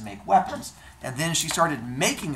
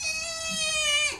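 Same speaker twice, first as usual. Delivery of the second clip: very high thin squeal; mock scream. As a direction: squeal in hammed-up alarm, held long. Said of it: "Hephaestus starts screaming".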